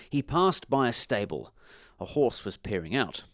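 usual speaking voice

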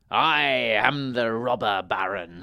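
loud, drawn-out announcement; robber baron's shout. A narrator's reading. Robber baron ambushing the wizard in the wood, shouting. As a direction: boom it out slowly like a villain. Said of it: "he shouted".

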